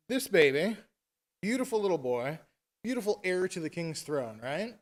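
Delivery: usual speaking voice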